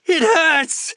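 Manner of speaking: very high, loud wail of pain. Acted. Wounded Xander tells the medics, wailing in pain. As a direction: wail it out in agony. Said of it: "wailed Xander".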